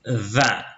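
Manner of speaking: "Va" begun with voiced th, as in this; tongue tip between the teeth on "Va"; interdental consonant. Shown as a demonstration. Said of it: "Cette lettre est aussi interdentale".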